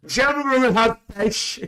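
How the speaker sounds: high, grand announcing voice; mock announcement. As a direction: announce it like a waiter. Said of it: "announcing to viewers".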